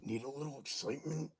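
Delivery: ordinary talk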